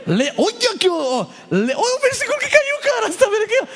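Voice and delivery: high, loud, laughing excitement; squealing with glee over the verse; excited laughter. Pretend. Acting excited evangelist in metro.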